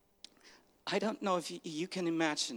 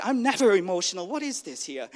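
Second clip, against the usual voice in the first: loud, higher-pitched retold protest, easing off at the end; emotional outburst; 3 semitones higher, 7 dB louder than usual. Man retelling a seeker's startled reaction, emotional outburst. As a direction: burst out with it, then drop back. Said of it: "he was so emotional".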